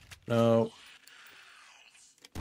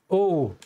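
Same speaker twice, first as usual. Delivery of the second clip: real startled "oh", pitched up then falling; startled cry; 5 semitones higher than usual. Real, not acted. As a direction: cry out at a sudden jump scare. Startled by a game jump scare.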